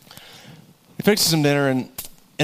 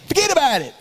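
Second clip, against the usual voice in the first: quick, higher mock Chicago accent; 8 semitones higher, faster than usual. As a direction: throw in the bad Chicago accent. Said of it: "how they do that in Chicago".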